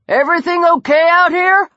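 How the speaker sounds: high, loud call from a distance. Acted. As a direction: call across the square. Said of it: "a guard call".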